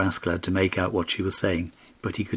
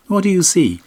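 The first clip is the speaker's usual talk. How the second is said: much higher, light pony voice; talking pony; 10 semitones higher, 7 dB louder than usual. A narrator's reading. Magic pony asking its rider, high and light.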